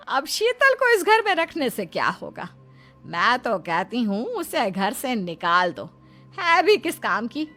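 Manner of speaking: high, indignant mother; swooping, scornful dismissal of an unwanted daughter-in-law. Acted. Mother urging son to throw wife out, scornful.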